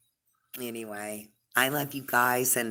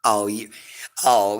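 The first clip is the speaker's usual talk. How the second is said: normal talking voice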